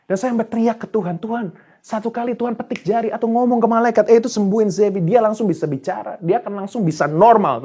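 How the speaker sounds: high, pleading complaint without a full shout; crying out to God. Retold or imitated. Father pleading to God for his son, retold high-pitched outcry. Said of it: "saya teriak ke Tuhan".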